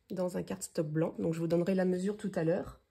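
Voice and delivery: usual speaking voice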